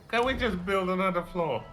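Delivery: ordinary talk, nothing marked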